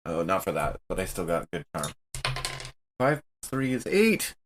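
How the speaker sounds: normal talking voice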